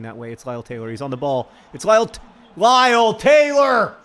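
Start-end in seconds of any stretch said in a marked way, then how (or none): 1.7-4.0 much louder, higher shouting of the name; excited shout. Real, not acted. Gamer urging his striker on, excited shout. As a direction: yell the name as he breaks. Live commentary of own striker.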